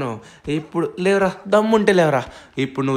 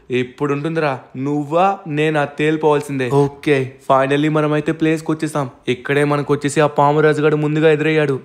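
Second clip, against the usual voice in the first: ordinary talk, nothing marked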